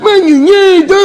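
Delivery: high, slow, choked voice; high strangled mock Romeo. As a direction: Romeo squeezed by the railing.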